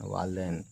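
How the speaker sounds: वालदैन restated softly with open ai; correct ai vowel. Shown as a demonstration. Teacher restates the right reading for learners, soft dain ending. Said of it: "वालदैन पढ़ते हैं".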